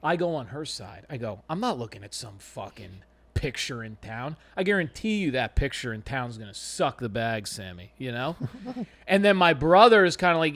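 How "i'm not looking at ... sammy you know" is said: low, soft aside to his sister; a hushed aside to his sister; conspiratorial aside. Retold or imitated. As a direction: mutter it on her side, much softer. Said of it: "this is how I'll help".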